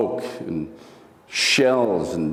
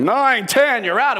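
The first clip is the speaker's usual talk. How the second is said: higher and louder boxing count; referee count-out; 10 semitones higher, 3 dB louder, slower than usual. Retold or imitated. Referee counting out a boxer on the canvas, loud call.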